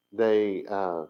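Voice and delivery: usual speaking voice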